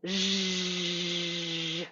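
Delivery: long steady buzzing zh; drawn-out voiced hushing sound. Shown as a demonstration. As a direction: sustain a buzzy zh on one low pitch. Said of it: "So now we're doing".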